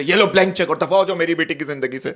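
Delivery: a hint of a stern film father; lightly voiced angry rich father; old film father. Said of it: "पुरानी फिल्मों वाले बाप".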